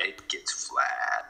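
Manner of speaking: flat Canadian accent, trailing low. Shown as a demonstration. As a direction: stretch the flat Canadian vowels slowly. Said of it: "if I get excited or talk real fast".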